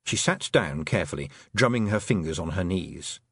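plain reading voice

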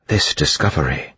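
plain reading voice

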